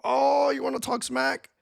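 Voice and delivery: high, drawn-out mock "oh" taunts; mock taunting. They rush at you talking back, high mock taunting. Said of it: "They're gonna rush at you and be like".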